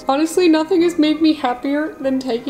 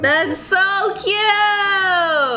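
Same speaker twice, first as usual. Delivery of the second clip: high, long-drawn coo; gushing coo; 7 semitones higher, much slower than usual. Real, not acted. Owner reacts to how cute he looks, gushing. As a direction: draw it out in high-pitched adoration. Cooing over the pet.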